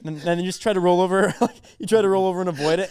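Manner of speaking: usual speaking voice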